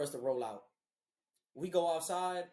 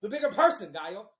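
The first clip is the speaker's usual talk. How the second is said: exasperated, building to a loud burst; mock-angry outburst in a retelling; retold exasperation; 8 dB louder than usual. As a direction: replay being mad, punch it out louder at the end. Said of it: "I was so mad".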